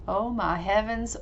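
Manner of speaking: ordinary talk, nothing marked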